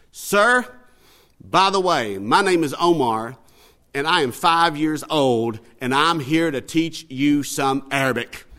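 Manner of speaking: put-on loud, slow, deliberate little-boy quote; imitated boy's formal, measured declaration. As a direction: announce it slowly and boldly, as a small boy. Storyteller voices the bold little boy.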